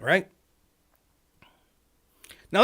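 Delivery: ordinary talk, nothing marked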